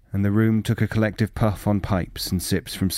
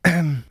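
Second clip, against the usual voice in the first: short high nervous cough; single cough; 8 semitones higher, 4 dB louder than usual. Acted. Flustered official coughing mid-plea to Hunt. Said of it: "he coughed once".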